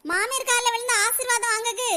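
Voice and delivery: usual speaking voice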